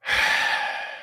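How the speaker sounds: long, sorrowful exhale; heavy sigh. Real, not acted. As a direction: let out a weary breath. Grieving the dead babies.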